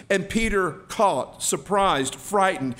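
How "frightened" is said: ordinary talk, nothing marked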